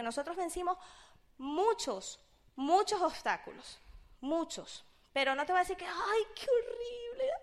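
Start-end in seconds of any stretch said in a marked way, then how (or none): 5.9-7.3 high, slow put-on whine; lightly mocking slow moan. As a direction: whine it like a spoiled complainer, then drop it. Mock complaint she denies.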